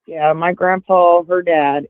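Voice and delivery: ordinary talk, nothing marked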